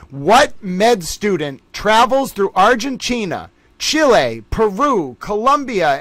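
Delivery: high, loud game-show question read, slow; quiz host read. Acted. Trivia host reading the question to the chat, high and loud. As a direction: announce each option like a quiz show host.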